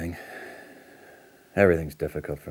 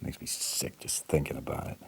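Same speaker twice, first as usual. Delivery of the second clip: disgusted whisper; 10 dB quieter than usual. Acted. Disgusted murmur.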